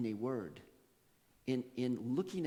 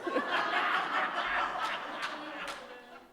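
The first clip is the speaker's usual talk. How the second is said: long unvoiced real laugh; breathy real laughter; 3 dB louder than usual. Real, not acted. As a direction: let the laugh roll out. Laughing after the quote.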